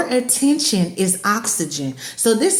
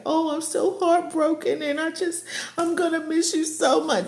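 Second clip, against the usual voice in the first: soft, higher, hammed-up heartbroken plea; mock fake-crying narcissist; 5 semitones higher, 7 dB quieter than usual. Mocking the ex's fake heartbroken goodbye, soft and whiny. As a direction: whimper it like a fake sad ex.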